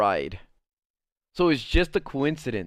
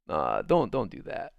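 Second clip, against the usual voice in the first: cringing reaction; 7 semitones lower than usual. Retold or imitated. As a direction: imitate an awkward, reluctant objection. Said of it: "It was kinda like".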